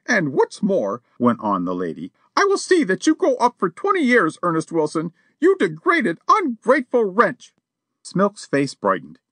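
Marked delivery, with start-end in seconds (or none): 0.1-7.5 high-pitched outraged society lady; a lady defying a burglar, high and indignant. A narrator's reading. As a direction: threaten him from injured dignity. Missus Champney voiced.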